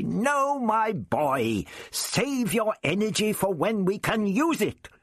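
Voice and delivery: high, pleading desperate shout. A narrator's reading. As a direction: cry out to stop him. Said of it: "Again the Doctor shouted desperately".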